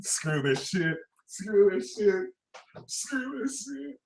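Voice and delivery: soft, fading, defeated mutter; muttered give-up. Pretend. Reader giving up on Capricorn, soft defeated mutter. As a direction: trail off like you're done.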